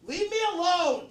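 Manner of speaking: high, whiny mock yell back; bratty retort. Kid talking back to mom at Little League, high mock yell.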